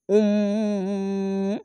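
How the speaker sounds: উম with a humming nasal ghunna on the মিম; nasal humming ghunna demo; iqlab ghunna. Shown as a demonstration. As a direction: hold the মিম and hum it through the nose. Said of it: "ইকলাব গুন্না এই মিমটিকে উচ্চারণ করতে হবে".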